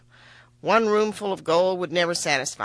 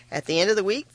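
usual speaking voice

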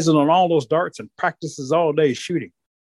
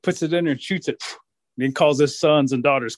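ordinary delivery, nothing marked

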